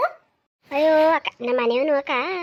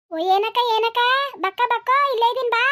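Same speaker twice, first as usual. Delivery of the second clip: higher and louder, answering from across the field; calling back; 7 semitones higher, 5 dB louder than usual. Acted. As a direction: call out to her friend from a distance, in a different voice. Neighbour answers from far off.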